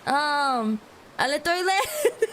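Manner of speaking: normal talking voice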